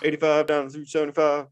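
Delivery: excitable dad impression, talking roads; imitating dad. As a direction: play dad eagerly quizzing a visitor about the route. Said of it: "my dad goes".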